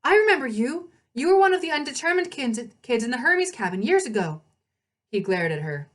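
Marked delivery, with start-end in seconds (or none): 0.0-4.4 higher, louder startled recognition; surprised gasp. Acted. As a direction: blurt it out in shock. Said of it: "Annabeth gasped".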